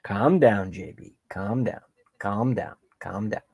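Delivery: dropping to a soft, near-whisper self-soothe; calming himself down. Real, not acted. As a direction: talk yourself down quietly, fading to a whisper. Calming himself after rant.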